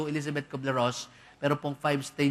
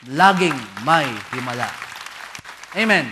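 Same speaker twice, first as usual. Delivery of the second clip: loud, ringing declaration to the congregation; loud proclamation; 3 semitones lower, 8 dB louder than usual. Real, not acted. Preacher proclaiming miracles to the congregation, loud and ringing. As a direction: proclaim it to the whole church.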